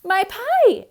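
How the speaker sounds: high, loud cry of alarm; exclaimed. A narrator's reading. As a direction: squeal it out in a panic. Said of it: "exclaimed Amelia Bedelia".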